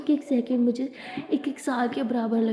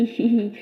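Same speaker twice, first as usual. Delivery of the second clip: giggle; 2 semitones lower, 3 dB louder than usual. Acted. Abira giggles.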